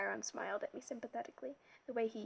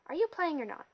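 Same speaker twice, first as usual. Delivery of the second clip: impatient snap, higher and louder; 7 semitones higher, 9 dB louder than usual. A narrator's reading. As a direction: bark it out, losing patience. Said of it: "said impatiently".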